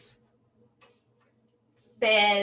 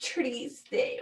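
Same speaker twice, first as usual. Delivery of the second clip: soft, teary "Triste"; soft mock sad; 7 dB quieter than usual. Shown as a demonstration. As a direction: let it sink softly, almost crying. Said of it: "tears coming in my eyes". (triste suave y afligido)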